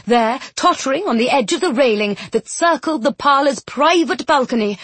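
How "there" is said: plain reading voice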